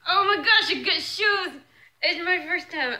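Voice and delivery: loud, high burst of joy; overexcited squeal of delight; too excited. Real, not acted. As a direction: blurt it out, thrilled. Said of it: "I'm too excited right now".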